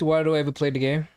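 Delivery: ordinary delivery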